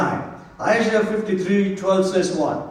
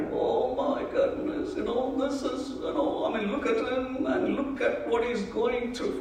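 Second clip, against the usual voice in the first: soft, high-pitched lament; mock mourning; 5 semitones higher, 10 dB quieter than usual. Preacher mimicking churchgoers on Good Friday, mock mourning. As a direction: put on a hushed, pitying wail. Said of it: "we usually tend to mourn".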